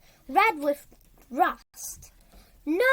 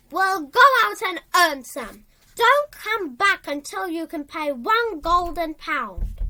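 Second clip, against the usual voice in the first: harsh order; 2 semitones higher, 8 dB louder than usual. A narrator's reading. Landlords ordering Flora out.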